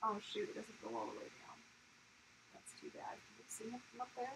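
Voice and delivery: fast breathy whisper; hushed whisper so he stays asleep; whispering over a crib. Real, not acted. Baby asleep nearby.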